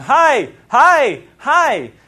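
high, loud repeated shouts; imitated high-pitched hollering; yelling. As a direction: yell it sharply to get someone's attention from behind. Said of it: "behind me yelling".

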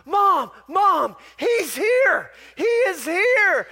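put-on a boy yelling to his mom, thrilled; breathless excited shout. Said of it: "runs up to her out of breath and goes".

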